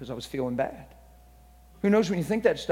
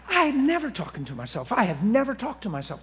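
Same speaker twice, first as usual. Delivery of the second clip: mock protest; 7 semitones higher than usual. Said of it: "I said".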